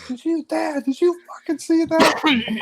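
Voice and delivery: high-pitched, furious yell at dad; furious kid yelling. Retold or imitated. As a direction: yell it like a raging eleven-year-old. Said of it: "I'm punching the couch going".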